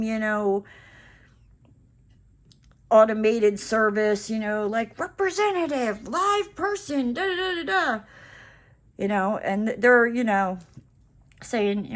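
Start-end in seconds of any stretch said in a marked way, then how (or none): 5.0-8.0 half-hearted impatient shout at the robot; retold yelling at a phone menu. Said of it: "I go through the whole screaming".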